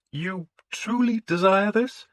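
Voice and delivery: the priest doubting the lord's sudden faith; suspicious priest. A narrator's reading. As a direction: test him, the suspicious priest. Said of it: "He was suspicious".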